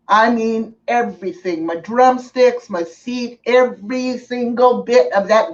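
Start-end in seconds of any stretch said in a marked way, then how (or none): none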